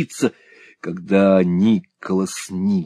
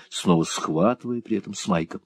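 usual speaking voice

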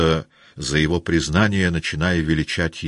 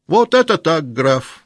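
much higher, louder approving cry; hearty shout; 12 semitones higher, 8 dB louder than usual. Acted. The staff captain cheering Rostov, loud and high. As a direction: clap him on the shoulder with your voice. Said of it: "крикнул штаб-ротмистр".

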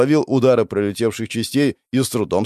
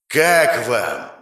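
demonic voice; 2 semitones higher than usual. Acted. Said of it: "Демонический голос достигает каждой головы".

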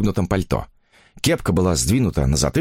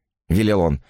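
plain reading voice

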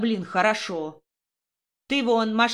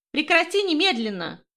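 high shrill-free yell, pitched way up; hysterical shout; 7 semitones higher than usual. A narrator's reading. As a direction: scream at him to stop right now. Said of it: "истерично закричала девушка". (истеричный крик)

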